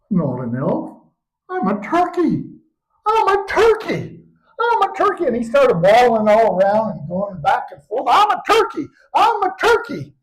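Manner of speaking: high squeaky bird-like cries; squawking turkey boy. Acted. Boy discovering he's a turkey, high squawking cry.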